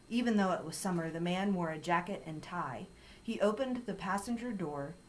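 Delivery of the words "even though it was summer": plain reading voice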